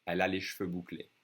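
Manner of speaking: cheveux squeezed to one syllable "shveu". Shown as a demonstration. The way she would say it to a friend, dropped E. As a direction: say cheveux without its first E. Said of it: "I drop the E".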